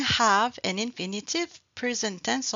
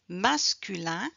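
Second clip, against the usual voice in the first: usual speaking voice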